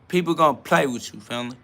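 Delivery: ordinary talk, nothing marked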